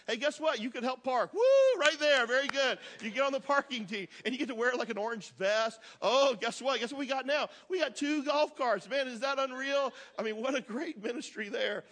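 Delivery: mock-giddy cheerleading with a whoop; playful hype. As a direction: sell it like thrilling news. Hyping parking team to the congregation.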